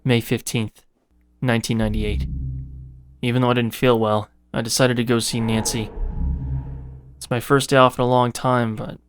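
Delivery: measured, grim voice reading a diary. Acted. Player reading a keeper's diary aloud, low and grim. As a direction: read the diary entries like a worn-out keeper.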